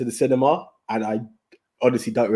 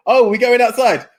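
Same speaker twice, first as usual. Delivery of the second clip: higher, louder taunting offer to step outside; mock challenge; 9 semitones higher, 5 dB louder than usual. Brothers standing up to the man in the cinema, taunting. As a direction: rise from the chair and call his bluff. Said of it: "that push up from a chair".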